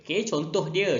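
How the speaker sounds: normal talking voice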